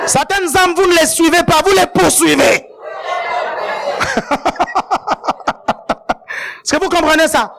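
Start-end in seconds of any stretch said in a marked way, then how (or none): 0.1-2.7 raised, higher preaching voice; emphatic preaching cry. Real, not acted. Preacher urging church to pursue new souls, emphatic cry. 2.8-6.6 long laugh breaking into pulses; staccato laugh. Real, not acted. Preacher laughing after his line on pursuing souls, staccato laugh.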